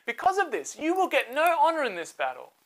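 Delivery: high, stern rebuke; Deborah rebuking. Acted. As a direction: tell him he loses the honor.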